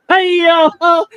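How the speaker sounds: high loud laughing shout. Real, not acted. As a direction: shriek with laughter. Laughing hard at a joke.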